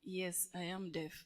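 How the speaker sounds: ordinary delivery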